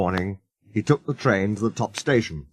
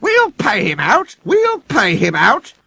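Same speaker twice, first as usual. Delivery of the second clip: scheming coaches; 12 semitones higher, 5 dB louder, slower than usual. A narrator's reading. Coaches plotting.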